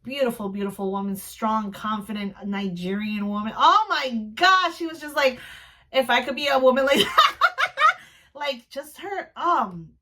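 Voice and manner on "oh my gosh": drawn-out, raised-pitch exclamation; awed gushing. Real, not acted. Fan recalling a confident woman, awed gushing. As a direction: stretch it out in admiration.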